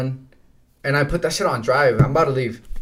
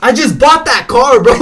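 higher and louder furious complaint; angry yell; 10 semitones higher, 11 dB louder than usual. Retold or imitated. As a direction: yell it like the car owner who just bought it. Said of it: "But he's yelling at me".

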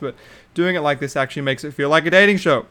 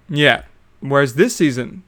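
normal talking voice

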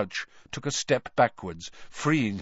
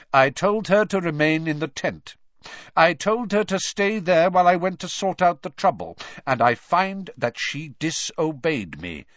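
higher, louder, emphatic accusation; stern anger; 7 semitones higher, 6 dB louder than usual. A narrator's reading. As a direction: lay out the offence sternly, slowing at the end. Master condemns his disobedient elf.

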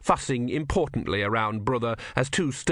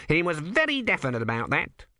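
lightly voiced nervous cleric, raised pitch; a fussy young monk, pitched up; high fussy monk; 6 semitones higher than usual. A narrator's reading. Numrod voiced by narrator.

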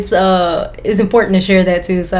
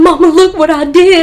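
put-on higher, louder little-kid voice; excited kid showing off; 10 semitones higher, 5 dB louder than usual. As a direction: imitate a child calling Mama. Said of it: "talking about some".

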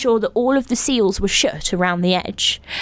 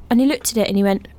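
usual speaking voice

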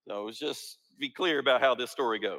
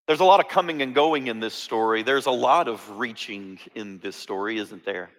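ordinary talk, nothing marked

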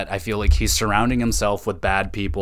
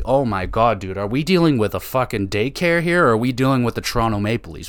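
normal talking voice

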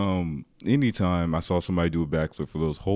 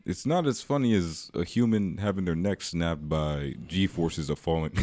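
ordinary talk, nothing marked

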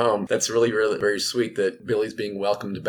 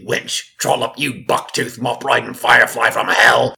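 Cockney undead growl, much louder by the end; Billy's Cockney voice; 3 semitones higher, 9 dB louder than usual. Acted. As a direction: spit the Cockney insults like a zombie. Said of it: "Billy had a gravelly, Britishy, Cockney kind of voice".

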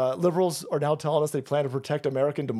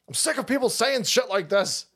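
higher-pitched, fed-up retort; exasperated impression, pitched up; mock-irate general; 8 semitones higher than usual. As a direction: imitate a general cutting someone off, sick of it. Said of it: "General Flynn goes".

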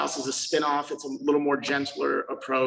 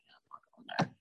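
breathy whisper, much softer; whispered aside; 8 dB quieter, much faster than usual. Retold or imitated. A guy who just toured the house, whispering his real verdict. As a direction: whisper it to yourself, turning the place down. Private aside after the tour.

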